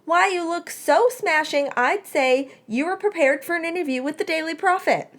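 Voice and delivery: high, mock-admiring tease; teasing sarcasm. A narrator's reading. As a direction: pile on the fake compliment. Remus teases crying Melody.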